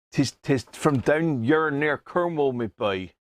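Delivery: slow, hammed-up Cornish yokel accent. Showing off the local product in a put-on Cornish accent. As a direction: drawl it out like a Cornish farmer. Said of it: "down in Cornwall".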